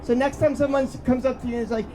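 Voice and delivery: ordinary delivery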